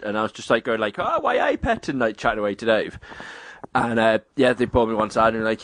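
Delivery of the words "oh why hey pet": high, put-on Geordie greeting; Geordie accent. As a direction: do a chummy Geordie impression, pitched way up. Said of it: "I was doing a Geordie".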